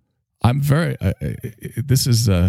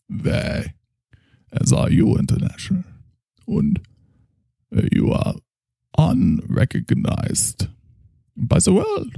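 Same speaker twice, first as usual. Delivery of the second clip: German doctor, probing; 11 semitones higher than usual. Acted. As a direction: speak as a solemn German therapist, pausing often.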